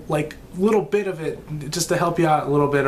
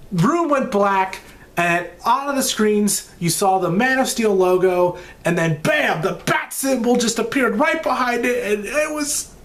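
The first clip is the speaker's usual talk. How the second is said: building excitement; 6 semitones higher than usual. Real, not acted. Building to reveal.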